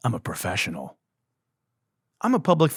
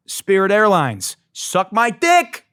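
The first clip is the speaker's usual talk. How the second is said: angry yell; 9 semitones higher, 5 dB louder, slower than usual. Real, not acted. Furious sign-off at the airline.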